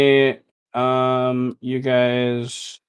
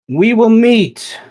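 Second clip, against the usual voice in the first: much higher, louder, slow and drawn out; yelled reply; 11 semitones higher, 9 dB louder, slower than usual. Acted. Mel yells back across the marsh, loud and slow. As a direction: call far across the marsh. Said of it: "she yells back out".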